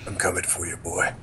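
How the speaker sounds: low, quiet mock threat; mock menace. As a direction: play at creeping menace, very softly. Playful menacing line after knocking.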